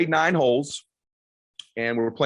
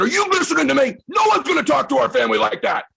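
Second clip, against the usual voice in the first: much higher, louder barked orders; angry yell; 13 semitones higher, 6 dB louder, faster than usual. Retold or imitated. Dad yelling at his son to stop talking about fun, loud. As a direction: yell him into wanting to win.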